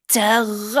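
high, loud trumpeting call; elephant trumpet. Acted. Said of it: "tief Luft holte und".

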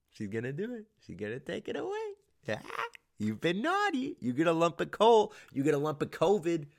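high, put-on taunting voice; hammed-up, squeaky holiday threat; mock naughty-list scolding. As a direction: scold like a cartoon Christmas judge. Mock villain cancelling Christmas, scolding.

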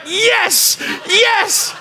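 high, pushed yes; mock eager yes; insistent yes. Landscaper insisting.

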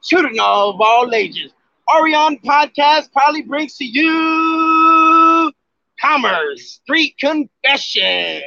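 booming wrestling ring announcer; grand entrance announcement. Pretend.

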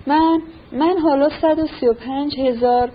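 usual speaking voice

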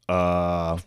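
low, soft, uneasy question; mock awkward reply. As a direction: answer quietly, at a loss. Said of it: "And he's like".

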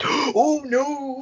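high, startled gasp; a baby realising in panic; mock gasp oh no. Baby meme voiced.

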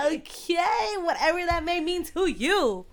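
high, loud hype-woman echo; hammed-up excited agreement; playful hype. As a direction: sing it out high and loud in jest. Hyped echo, joking.

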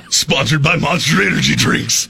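mock event-announcer sponsor plug; growing hype like a rally promo; tractor pull voice. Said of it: "tractor pull voice".

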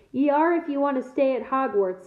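usual speaking voice

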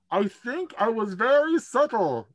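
Brewer peeks in, proud of himself, high voice; Brewer's voice. Acted.

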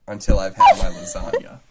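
laughing. Real, not acted. Laughing through the joke text.

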